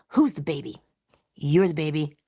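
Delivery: normal talking voice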